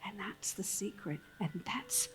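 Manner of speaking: low, hushed inspirational close. Acted. Closing the show for the audience, hushed and inspiring.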